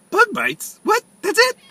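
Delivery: can't believe it's that simple; disbelief. Retold or imitated. Patient hears harmless diagnosis.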